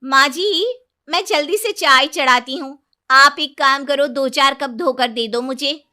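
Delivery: loud and high, ordering her mother-in-law about; young bride giving orders, cheeky and loud; bossy new daughter-in-law. Acted.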